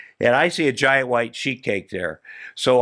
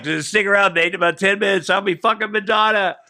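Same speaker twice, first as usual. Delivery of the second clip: cocky boast; 8 semitones higher than usual. Retold or imitated. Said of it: "He's like".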